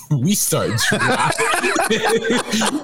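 words squeezed out through laughter; laughing. Real, not acted. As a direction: laugh as you say it. Laughs through the line, then repeats it.

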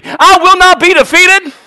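high, loud congregational chant; bold, defiant pulpit cry; rallying shout. Real, not acted. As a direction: shout it for the crowd to echo. Congregation told to repeat it.

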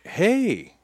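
high shouted hey across the yard; calling out. Retold or imitated. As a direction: call out to distant kids. Calling out to kids far away.

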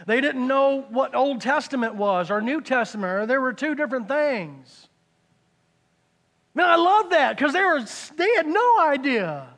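loud, high, impassioned preacher's cry; full-force preaching. Real, not acted. Preacher challenging the congregation about getting judgy, full-force preaching. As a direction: preach it loud, voice pitched right up.

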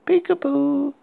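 put-on high, eerie little girl singsong; a creepy child calling out. A narrator's reading. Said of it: "a faint voice of a little girl".

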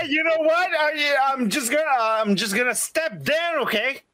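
acted-out quitter. Acts out the executive quitting.

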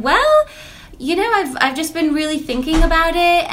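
high, light imitation of a teen student; lightly voiced student explaining; student's reply. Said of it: "And she said".

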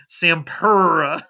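"Sampura" slow, with a long drawn-out UR; spelled-out mispronunciation. Shown as a demonstration. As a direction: stretch the middle of "Sampura" into sam-PURRR-uh. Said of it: "P U R U H".

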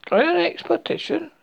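eager high question; excited bear asking. A narrator's reading. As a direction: ask with bright eagerness. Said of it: "said Pooh eagerly".

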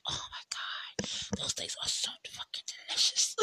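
unvoiced awed whisper; hushed craving over mangoes. Real, not acted. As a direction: whisper it like a delicious secret. Raving about mangoes.

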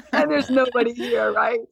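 amused at herself, laughing as she says it. Real, not acted. Telling the host about her empty kitchen, laughing.

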